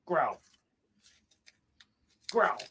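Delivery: ordinary talk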